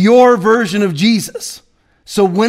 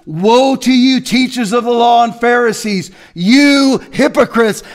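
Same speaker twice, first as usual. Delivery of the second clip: shouted rebuke; 5 semitones higher than usual. Shown as a demonstration. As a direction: hurl the woe like a prophet. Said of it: "This is how he started numerous sentences".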